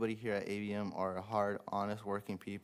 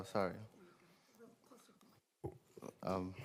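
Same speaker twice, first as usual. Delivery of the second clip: normal talking voice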